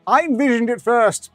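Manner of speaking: high, loud, insistent claim; excited boast. Real, not acted. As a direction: proclaim it loudly, claiming the credit. Excitedly claims the idea as theirs.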